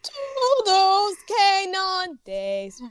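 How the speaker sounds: put-on high, very slow French accent. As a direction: stretch it out in a hammy French accent. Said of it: "went with the French accent".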